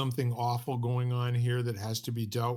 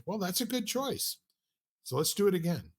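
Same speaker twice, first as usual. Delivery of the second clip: much higher, sing-song praise from a seminar leader; mock seminar leader praise; 7 semitones higher than usual. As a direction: pat the volunteer on the head with a high, overdone "good choice".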